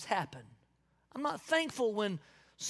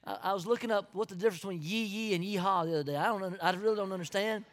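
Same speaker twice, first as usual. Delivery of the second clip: normal talking voice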